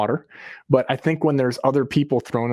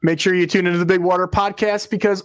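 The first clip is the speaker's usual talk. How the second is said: high, hammed-up promo read; hyped announcer voice; 9 semitones higher than usual. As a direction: belt out the sign-off like a hype man. Shows up partner's announcer read.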